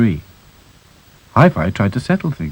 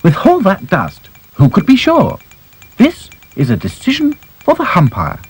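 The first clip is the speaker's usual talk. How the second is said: high posh-sounding princess voice; haughty princess; 11 semitones higher than usual. Acted. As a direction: pronounce it grandly, high. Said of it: "said Princess".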